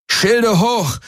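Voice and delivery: high, yelled command; shouted order. Acted. Garland yells at the ship under enemy fire, shouting. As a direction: roar the order over the battle. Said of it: "brüllte Garland".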